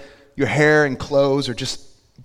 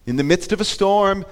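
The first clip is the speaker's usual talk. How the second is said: ordinary talk, nothing marked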